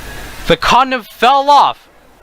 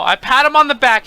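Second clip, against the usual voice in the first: ordinary talk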